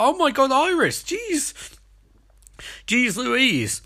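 high, shocked squeal of disbelief. Retold or imitated. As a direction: yelp it out like you just saw a crowbar hit. Reaction to Iris smashing Peekaboo.

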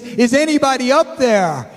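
a man hanging off a cliff, calling up to the sky; desperate hiker. Retold or imitated. Hiker dangling from a branch calls to heaven, high and desperate. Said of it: "and he says".

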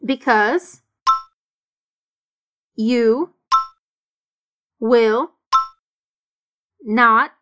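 one word at a time, with pauses between; slow dictation, each word separate. Shown as a demonstration. Teacher pacing students word by word.

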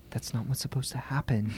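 hushed urgent whisper; breathless whispered warning; retold whisper. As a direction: whisper it so nobody else hears. Emily hissing a warning at sister.

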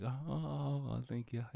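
soft, high-starting courteous retreat; gently awkward polite deflection; polite brush-off. Shown as a demonstration. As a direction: demonstrate easing away with hushed thanks. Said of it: "it's kinda my move".

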